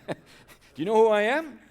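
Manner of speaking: put-on high, loud jolly old man calling; cried the old man. Tom calming the panicked hobbits, high loud jolly call. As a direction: boom it out like a merry old fellow.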